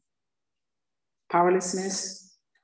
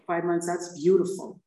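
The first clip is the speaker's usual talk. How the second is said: normal talking voice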